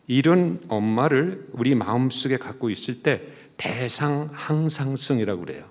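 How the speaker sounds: ordinary delivery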